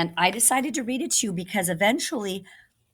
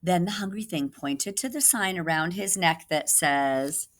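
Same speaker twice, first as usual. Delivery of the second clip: usual speaking voice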